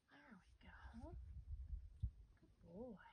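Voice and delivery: whispered slow praise, unvoiced; soft slow whisper to a horse. Real, not acted. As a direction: breathe the words to calm the horse. Calming horse during handling.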